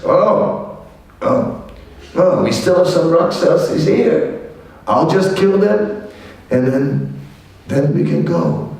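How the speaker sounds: put-on a cocky young hero, keen to fight; Hanuman boasting. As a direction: brag it like nothing can stop you. Hanuman boasting he'll kill them.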